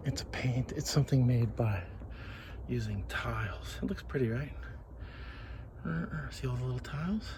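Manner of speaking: soft admiring whisper. Real, not acted. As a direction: hush as if in a gallery. Quiet by the mosaic.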